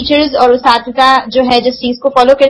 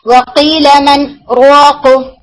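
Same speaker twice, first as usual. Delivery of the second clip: one breath, no break between words; short pause recitation; much slower than usual. Shown as a demonstration. Teacher recites वक़ील मन राक़ to students, joined smoothly. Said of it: "तो आप इसको कैसे पढ़ेंगे".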